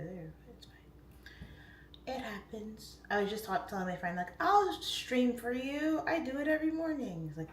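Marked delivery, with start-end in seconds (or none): 4.4-7.3 airy, high-voiced mock confidence; mock breezy boast. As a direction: imitate yourself promising a friend, pitched up. Mimics herself boasting to a friend.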